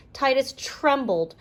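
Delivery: ordinary delivery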